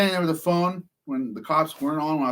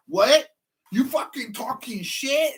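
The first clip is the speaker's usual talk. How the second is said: higher, slow, challenging mock tough guy; angry worker; 10 semitones higher, slower than usual. Worker confronts taunting man, higher mock tough guy. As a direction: play the riled-up guy.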